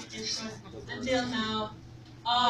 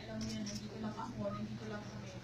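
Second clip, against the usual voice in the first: soft; 4 semitones lower, 14 dB quieter, faster than usual. Real, not acted. Emotional farewell message, fading.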